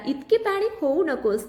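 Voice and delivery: ordinary delivery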